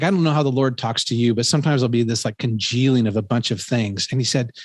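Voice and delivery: usual speaking voice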